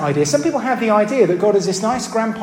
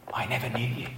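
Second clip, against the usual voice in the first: hushed, lower whisper; 8 semitones lower, 13 dB quieter, faster than usual. Acted. Preacher whispering Jesus's words to false followers. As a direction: breathe it like a grim verdict.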